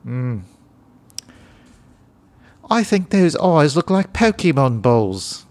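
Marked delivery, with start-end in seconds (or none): 2.7-5.5 higher, slow, hammed-up celebrity voice; a lightly voiced James Corden; James Corden impression. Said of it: "That's James Corden".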